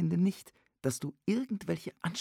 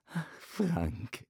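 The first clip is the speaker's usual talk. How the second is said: spottend nagedaan; 3 dB quieter than usual. Retold or imitated. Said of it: "äffte er sie nach".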